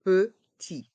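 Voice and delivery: "Petit" in two separate beats, silent final T; learner-paced, split "Petit"; syllable by syllable. Shown as a demonstration. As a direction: break "Petit" into pe and ti. Teacher breaks word into syllables.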